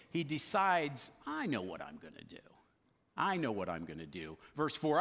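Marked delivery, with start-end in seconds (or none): 1.3-4.4 low, hushed self-talk; quietly voicing a schemer's decision; scheming aside. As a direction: drop it down like a private plan. Manager privately hatches his plan.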